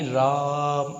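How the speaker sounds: राम with the आ held long; slow, lengthened आ in राम, demonstrating a prolonged vowel; stretched vowel. Shown as a demonstration. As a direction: draw out the middle vowel of राम. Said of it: "तो ये आ जो खींचा".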